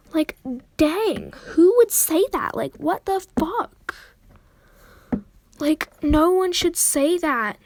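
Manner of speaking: high, loud, exasperated disbelief; indignant outburst. Real, not acted. Ranting to viewers about a friend's rude remark, indignant.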